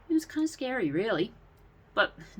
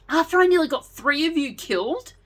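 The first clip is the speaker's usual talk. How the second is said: high and louder guilty outcry; crying out; 10 semitones higher, 7 dB louder than usual. A narrator's reading. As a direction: blurt out the guilt you've held in. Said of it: "Daniel cried".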